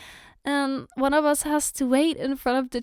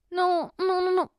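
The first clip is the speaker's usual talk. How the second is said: normal talking voice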